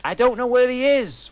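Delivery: a hammed-up high whine; pleading Lupin. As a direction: plead like a cornered captive. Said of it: "said Lupin".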